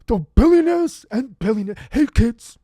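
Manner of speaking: high, loud politician impression; Bernie Sanders. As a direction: holler it like a hammed-up old senator. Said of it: "you're doing a Bernie impression?".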